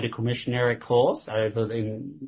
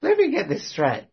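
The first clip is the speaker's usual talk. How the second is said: pointed retort; 12 semitones higher than usual. Retold or imitated. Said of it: "tell me if you think I'm fair".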